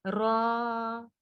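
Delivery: रॉ stretched long on a full, heavy r and held; drawn-out syllable. Shown as a demonstration. Teacher shows students how to stretch the letter, drawn-out syllable. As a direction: hold the vowel of रॉ long and steady. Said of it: "इसको आपने खींचना है".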